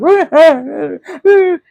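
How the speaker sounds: high, loud laugh breaking out; burst of laughter. Real, not acted. Recalls documenting her breakdowns.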